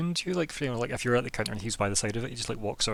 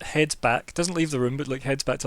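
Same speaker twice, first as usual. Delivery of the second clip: ordinary talk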